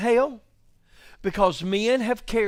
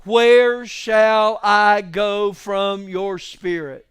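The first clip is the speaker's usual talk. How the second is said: slow, louder, drawn-out reading; slow, louder reading for the congregation to echo; slow call-and-response; 8 dB louder, much slower than usual. Shown as a demonstration. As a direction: lead the congregation to repeat it. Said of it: "Somebody else say that with me".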